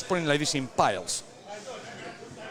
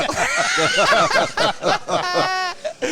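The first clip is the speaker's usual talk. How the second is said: loud burst of laughter; 14 semitones higher, 7 dB louder than usual. Real, not acted. Laughing at the host's joke.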